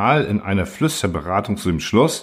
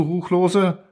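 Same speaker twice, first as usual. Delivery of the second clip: "Ruchlose" thrown up high as a disbelieving question; outraged question; 9 semitones higher than usual. A narrator's reading. The council confronts the machine, high incredulous "Ruchlose?". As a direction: ask "Ruchlose?" with a steep high rise. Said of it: "erzitterte der ganze Durinal ... und rief".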